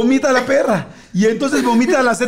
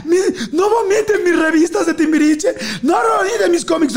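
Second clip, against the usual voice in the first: laughing shriek; 10 semitones higher than usual. Retold or imitated. Cousin shrieks at the others over her magazines: high laughing shriek. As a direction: wail for your ruined magazines.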